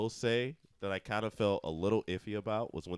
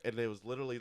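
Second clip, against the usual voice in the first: usual speaking voice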